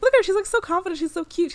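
high, loud burst of adoration; adoring gush. Real, not acted. Gushing over her confident Sim.

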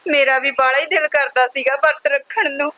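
a widowed daughter sobbing out her sorrow to her mother; tearful lament. Acted. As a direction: pour out her grief on the edge of tears. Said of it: "ਰੋ ਨਾ।".

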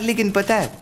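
ordinary talk

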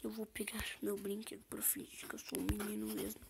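fast whispered aside; whispering. Pretend. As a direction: whisper the plan quickly. Alien's secret aside.

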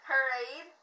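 loud, slurred through a mouthpiece; wearing a mouthguard. Real, not acted. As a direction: shout past a mouthguard. Reading card with mouthpiece in.

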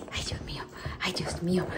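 soft breathy whisper. Real, not acted. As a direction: whisper it tenderly to the puppy. Cooing at puppy.

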